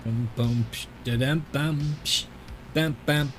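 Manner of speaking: idle mouthed drum beat; vocal drum sounds. Real, not acted. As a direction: tap out a beat with bums and pshes. Idly imitating drum sounds.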